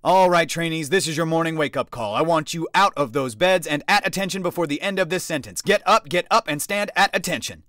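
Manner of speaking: loud, high barked orders; drill wake-up shout. A narrator's reading. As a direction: yell the barracks awake. Instructor's wake-up call.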